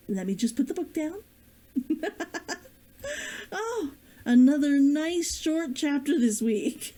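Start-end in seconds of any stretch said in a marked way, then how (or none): 1.7-2.5 laugh. Real, not acted. Breaks into laughter after chapter. 3.5-6.9 laughing. Real, not acted. Laughing through post-chapter chat.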